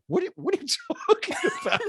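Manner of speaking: high, quick, laughing disbelief; incredulous retort. Retold or imitated. Host retelling his reply to the TV caller, laughing incredulity. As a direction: blurt it out baffled, breaking into laughter.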